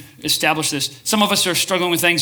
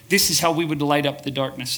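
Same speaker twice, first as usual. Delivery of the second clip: normal talking voice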